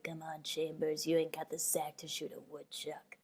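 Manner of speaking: low, soft menacing sneer, slowed; Ace from Stand By Me. Retold or imitated. Bully taunting Chambers with a gun, low and menacing. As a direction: play the teen bully, low and quiet. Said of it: "Ace in Stand By Me".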